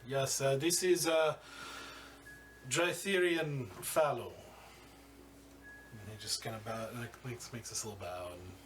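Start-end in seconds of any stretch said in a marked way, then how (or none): 0.1-4.3 smug crime boss. Acted. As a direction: introduce yourself like you own the room. Boss greets the party.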